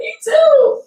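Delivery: very high, loud mock wail; imitating a friend bawling in agreement; mock crying. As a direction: squeal it like a hammed-up sob. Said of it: "We both started crying".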